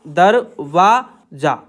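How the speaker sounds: दरवाजा drawn out very slowly; slow dictation. Shown as a demonstration. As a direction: stretch दरवाजा for the writers. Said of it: "कैसे बनाएंगे".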